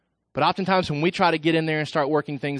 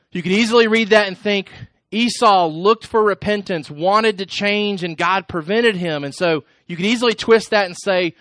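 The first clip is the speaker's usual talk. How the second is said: raised, driving sermon voice, higher and louder; preaching at full force; 5 semitones higher, 5 dB louder than usual. Acted. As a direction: press the point home from the pulpit.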